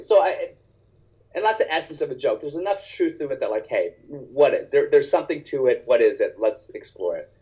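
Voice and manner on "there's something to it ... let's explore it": normal talking voice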